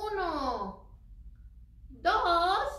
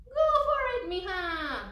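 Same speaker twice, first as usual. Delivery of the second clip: high, loud go-ahead easing down; encouraging mother; 6 semitones higher, 7 dB louder than usual. A narrator's reading. Mother lets her daughter open the present, high encouraging call. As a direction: cheer the child on, voice falling on the last word. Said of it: "her mother said".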